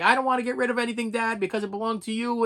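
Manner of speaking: son's protest. Retold or imitated. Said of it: "my son's like".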